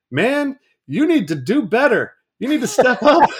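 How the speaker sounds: loud, high, urging the new dad to do better; fired-up street pep talk. Retold or imitated. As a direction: imitate him calling you out, big and loud. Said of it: "he looks at me and goes".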